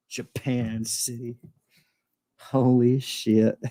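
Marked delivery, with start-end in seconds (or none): none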